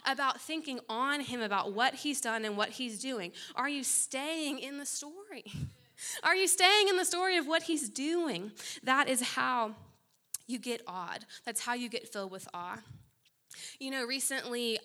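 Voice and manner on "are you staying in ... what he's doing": preacher pushes the congregation, louder and higher; louder, higher repeat. Shown as a demonstration. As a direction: press the question harder, louder. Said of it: "same words repeated louder".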